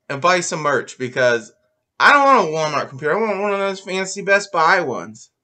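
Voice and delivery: usual speaking voice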